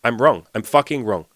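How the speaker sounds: escalating cry of I'm wrong; loud admission. Retold or imitated. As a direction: shout it out, finally admitting it. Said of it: "I, I, I was like".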